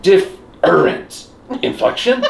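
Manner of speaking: "Different" broken up slowly, then a rise; drawn-out echo. Shown as a demonstration. Friends parodying Solid Snake's repeats, slow exaggerated question. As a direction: stretch "Different" into pieces, questioning. Echoing the line in turns.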